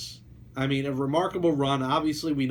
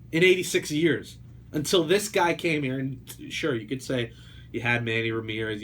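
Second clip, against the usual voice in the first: ordinary delivery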